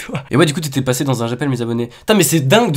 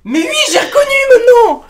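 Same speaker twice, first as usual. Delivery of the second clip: very high squealing shout; excited recognition; 4 dB louder than usual. Real, not acted. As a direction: squeal it out as you recognise them. Suddenly recognises the caller.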